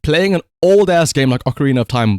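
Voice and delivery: ordinary talk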